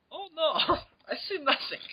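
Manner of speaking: high, crying mock Schultz; Sergeant Schultz voice. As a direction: wail it like a panicked guard. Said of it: "recurring characters".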